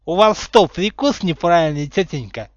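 mocking copy of childish speech; teasing parrot-back, settling toward the end; mimicking tease. A narrator's reading. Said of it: "передразнил её Поттер".

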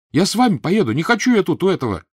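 beg, on the edge of tears; pleading boy. A narrator's reading. Mitya begging his father to take him, high desperate plea.

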